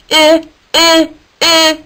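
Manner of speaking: high, loud beeping eh eh. Acted. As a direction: beep like a little alarm. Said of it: "Isaac beeped at him".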